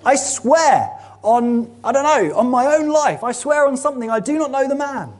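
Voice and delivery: high, frantic swearing of an oath; Peter swearing. Acted. Peter denying Jesus under oath, frantic high pleading. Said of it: "He swears".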